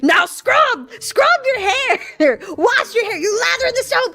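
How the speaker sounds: high, loud barked drill sergeant orders; drill sergeant yelling. Retold or imitated. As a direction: bark the shower orders down the hall. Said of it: "We could just hear him yelling down the hall".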